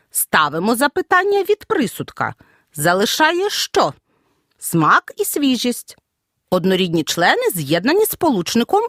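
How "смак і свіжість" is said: slow, each word stressed, with a pause before і; listing intonation. Shown as a demonstration. Teacher demonstrating enumerating intonation slowly. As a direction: spell out Смак, і, свіжість as separate items. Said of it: "вимовляємо з перелічувальною інтонацією".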